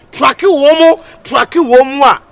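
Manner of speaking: high, loud chant of tracking womo; loud repeat. Acted. Hype man shouting tracking womo to the crowd.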